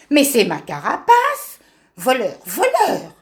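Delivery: angry tortoise shouting thief; indignant outburst. Acted. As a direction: accuse the thief, slowing on voleur. Discovers shell stolen, accuses thief.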